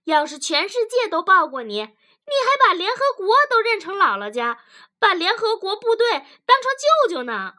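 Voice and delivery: loud, fast, high angry tirade; furious sarcasm. A narrator's reading. Wife mocks husband's attachment to relatives, furious. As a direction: build to a high, biting outburst. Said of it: "女子咬牙切齿地说".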